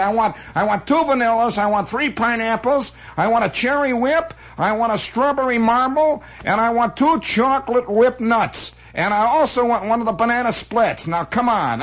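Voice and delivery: high, demanding put-on bluster; yelling slob customer. Slob orders from the custard man, demanding yell. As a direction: reel off the order like a pushy loudmouth. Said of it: "he starts yelling at this guy".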